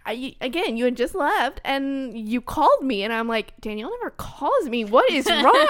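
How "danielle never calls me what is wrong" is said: louder and higher, mock worry; retold alarm. Friend reacting to an unexpected call, acted alarm. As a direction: build to an alarmed cry. Acts out her alarmed thoughts.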